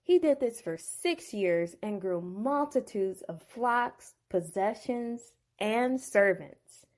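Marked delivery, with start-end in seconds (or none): none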